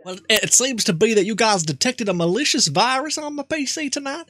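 lightly voiced old country man; Jebediah. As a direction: play a nervous rural grandpa. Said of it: "Jebediah might do".

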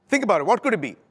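ordinary talk, nothing marked

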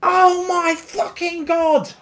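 normal talking voice

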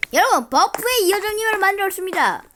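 high, loud excited announcement. Real, not acted. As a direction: announce it thrilled to viewers.

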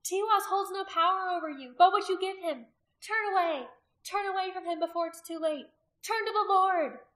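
high, loud urgent plea; desperate appeal. A narrator's reading. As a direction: beg the crowd to turn. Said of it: "was desperate for them to hear".

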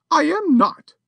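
high-pitched outraged society lady; a lady defying a burglar, high and indignant. A narrator's reading. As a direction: threaten him from injured dignity. Missus Champney voiced.

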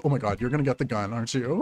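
usual speaking voice

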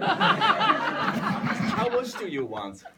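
high, slow words in laughter; laughing. Real, not acted. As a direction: squeak out through giggles. Laughing through the line.

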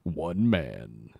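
deep, slow trailer announcer; dramatic movie trailer narrator. Acted. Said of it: "the movie guy voice".

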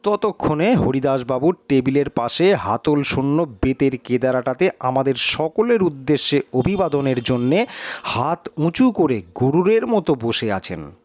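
ordinary delivery, nothing marked

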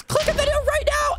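high, hyped shout; excited plea. Acted. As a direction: pitch it up like a hype call. Outro plea to viewers.

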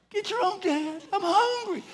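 very high mock whine of a child; whiny crying kid. Son guarding his fries from dad, tearful whine. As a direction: imitate a little boy starting to cry. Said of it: "He starts crying".

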